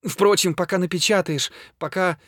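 high voice, dropping in force; anxious, disheartened aside; agitated despair. A narrator's reading. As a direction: let the wild hope falter, still high-pitched. Frantic patient losing hope midway.

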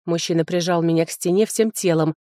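ordinary delivery, nothing marked

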